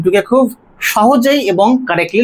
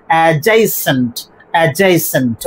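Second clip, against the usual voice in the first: Adjacent as "a-JAY-sent", weight on JAY; light first vowel, lean on "jay" in adjacent; stress on second syllable; 2 semitones lower, slower than usual. Shown as a demonstration. Teacher showing where stress falls, second-syllable stress. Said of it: "stress পড়বে".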